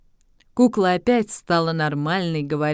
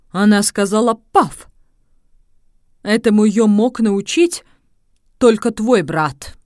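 agitated; 3 semitones higher, 6 dB louder than usual. Acted. Agitated father's outburst.

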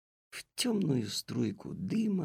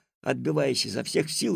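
ordinary delivery

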